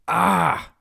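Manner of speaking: high loud startled scream; a small man's shriek of fright; tiny scream. Acted. Tiny prince screaming from her lap, a high shriek. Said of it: "came a scream".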